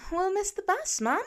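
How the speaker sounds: put-on high childish voice, whiny; a kid impatient to leave; little girl complaining to her mum; young girl protesting. A narrator's reading. Child voice of Lila.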